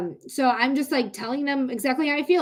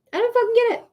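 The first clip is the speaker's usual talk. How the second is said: exasperated. Real, not acted. Venting about restrictions.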